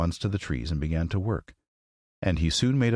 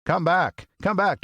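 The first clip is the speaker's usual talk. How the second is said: higher, louder call after them; 13 semitones higher, 4 dB louder, slower than usual. Acted. Woodman calling the scattering mice, loud call. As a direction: call the fleeing mice back. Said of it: "while he called to the mice".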